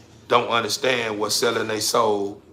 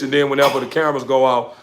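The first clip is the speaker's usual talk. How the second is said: normal talking voice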